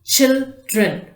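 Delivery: put-on "Children" broken as chil, dren; two separate beats on "Children"; split into syllables. Shown as a demonstration. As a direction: sound out "Children" with a gap in the middle. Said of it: "two syllables".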